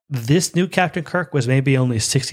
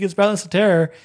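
mock excited scream; 7 semitones higher than usual. Fan wanting to run round the house, mock excited scream. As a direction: imitate screaming the news, pitched up. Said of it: "run around my house screaming".

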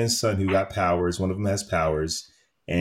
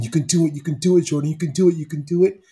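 higher, excited encouragement; higher and louder retold cheering, a touch faster at the end; mock cheering Clark; 8 semitones higher, 4 dB louder, faster than usual. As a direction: cheer him on like a proud dad. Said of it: "You hear Clark saying".

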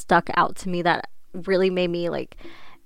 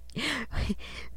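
whispered laugh; 5 semitones higher, 9 dB quieter than usual. Real, not acted. Laughing at the coworker's reply.

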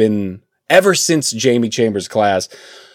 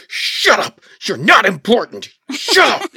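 hammed-up shut up, pitched way up; mock exasperated yell; 10 semitones higher than usual. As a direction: fake snapping at the Kardashians. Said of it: "where you just go".

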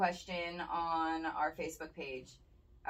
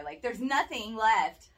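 louder, higher frustrated rant; exasperated rant; 8 semitones higher, 5 dB louder than usual. Retold or imitated. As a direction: vent the annoyance. Ranting about shopping crowds.

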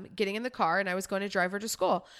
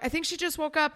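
retold panicked report; 7 semitones higher, faster than usual. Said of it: "I'm like".

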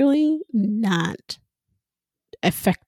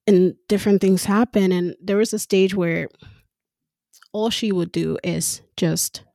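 ordinary delivery

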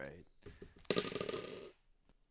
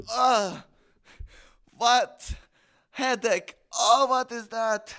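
higher, louder, groggy goblin voice; groggy hungover goblin; 4 semitones higher, 6 dB louder, slower than usual. Acted. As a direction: wake up dazed, head pounding.

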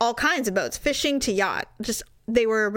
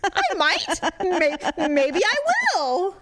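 high, sing-out taunting comeback; mock defiance; 9 semitones higher than usual. Taunting the boyfriend about dating another man, mock defiant. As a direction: re-enact escalating spiteful bravado.

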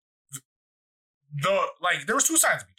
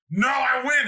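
high, loud triumphant retort; shouted refusal; 12 semitones higher, 6 dB louder, slower than usual. Retold or imitated. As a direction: holler back, refusing to let go. Opponent's shouted reply in retold wrestling.

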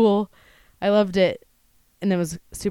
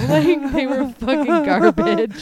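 loud, higher, laughing through it; 7 semitones higher, 6 dB louder than usual. Real, not acted. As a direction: laugh out the words. Laughing at bad pants.

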